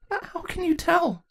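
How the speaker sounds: high, slow, tearful halting question; stuttered. Acted. Saronis stammers her question to the princess, high and tearful. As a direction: ask it through fear and tears. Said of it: "Serona stuttered".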